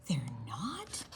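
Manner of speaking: hushed surprised whisper; reading aloud to children. Acted. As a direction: lean in and whisper. Reader stage-whispers aside.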